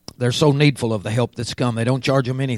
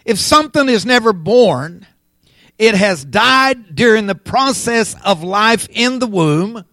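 raised, emphatic preaching voice; 6 semitones higher, 5 dB louder than usual. Real, not acted. Preacher teaching on life lost in the womb, preaching. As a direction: press each phrase on the congregation.

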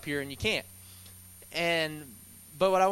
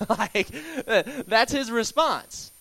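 laughing through the words, higher and louder; amused disbelief; 5 semitones higher, 4 dB louder, slower than usual. Real, not acted. Amused at Jesus' reply.

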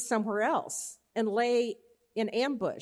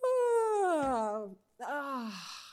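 drawn-out sleepy groan as he wakes; groggy waking yawn; 3 semitones higher than usual. Acted. Servant rubbing his eyes at dawn, sleepy groan. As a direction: stretch it out like a servant just waking. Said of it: "it's kinda like".

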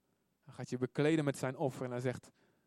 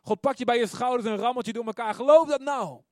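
high, very loud preaching cry; urgent exhortation; 7 semitones higher, 9 dB louder than usual. Acted. God shaking you to believe, loud urgent exhortation. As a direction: shout it as if shaking them by the shoulders.

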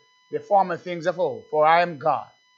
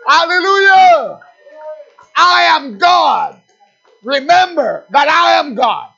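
loud, high, slow shouted praise; preaching at full force; 9 semitones higher, 9 dB louder, slower than usual. Acted. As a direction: lift the church with a shout.

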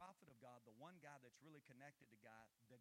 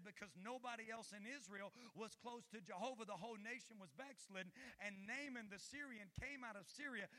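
raised preaching voice, driving the story; 8 semitones higher, 8 dB louder than usual. Acted. Pastor preaching to the church, raised voice. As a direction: preach it out to the congregation.

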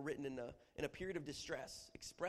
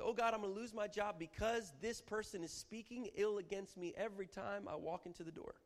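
distressed complaint; 6 semitones higher than usual. Acted. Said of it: "portrayal of distress".

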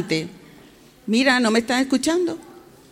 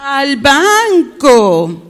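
very slow, sing-out BANCO; extra drawn-out confirmation; 2 semitones higher, 4 dB louder, much slower than usual. Shown as a demonstration. Teacher confirms the child's answer, very drawn-out. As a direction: echo it back, hugely stretched.

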